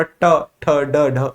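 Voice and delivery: ट ठ ड ढ each sounded separately, tongue curled back; sound drill. Shown as a demonstration. Teacher asks class to repeat, separate retroflex sounds. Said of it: "बोलो जरा".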